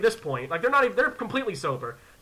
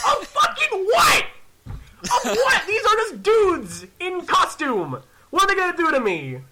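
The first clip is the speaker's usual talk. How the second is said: high, incredulous yelling; indignant outburst; 11 semitones higher, 4 dB louder, slower than usual. Retold or imitated. Ethan denying fear to Hannah, indignant. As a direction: scoff at the very idea, voice soaring.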